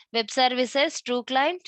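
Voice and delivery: normal talking voice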